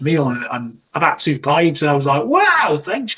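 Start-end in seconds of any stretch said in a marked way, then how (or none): 2.2-3.1 high-pitched acted wow; amazed thanks. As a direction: act pleasantly astonished, voice jumping up. Acted reaction to a free meal.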